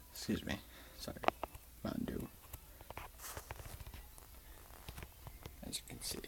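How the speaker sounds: soft whispered aside; whispering. Real, not acted. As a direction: whisper it off to the side.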